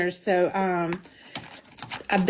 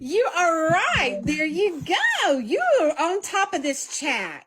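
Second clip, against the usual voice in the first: much higher, slow sing-song praise; excited praise; 8 semitones higher, slower than usual. Real, not acted. Praising Beth for listening in the chat, high sing-song. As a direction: cheer her on like a proud teacher.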